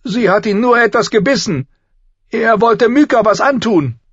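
put-on high, loud childish protest; loud protesting girl. A narrator's reading. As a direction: defend him at the top of her voice. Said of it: "widersprach Arya lauthals".

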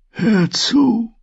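put-on faint urgent old voice; weak old maester. Dying maester addresses Osha, faint urgent voice. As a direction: call her attention, weakly.

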